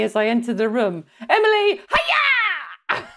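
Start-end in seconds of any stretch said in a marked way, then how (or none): 1.3-3.1 shrieked parrot greeting; squawking parrot. Acted. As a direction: squawk it out like a parrot, stretching "hiya". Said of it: "Squawking. So they'd be brilliant at announcing me".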